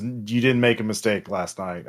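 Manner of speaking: ordinary talk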